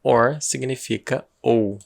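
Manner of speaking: slow, repeated for emphasis; slow explanation again. Shown as a demonstration. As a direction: say it slowly again to drive it home. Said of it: "O que significa or".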